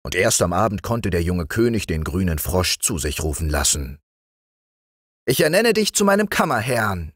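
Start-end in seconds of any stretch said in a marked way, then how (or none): 5.3-7.1 put-on raised, higher young king; king appointing his friend the frog chamberlain, higher voice; young king's voice. A narrator's reading. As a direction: play the young king granting an honour. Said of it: "sagte der König zu ihm".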